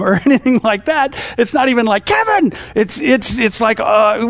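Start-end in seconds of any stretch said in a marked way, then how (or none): 2.0-2.5 high yelled call across the field; calling out a name. Pretend. Preacher mocks a father yelling for his son. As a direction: shout the name like a dad calling his kid.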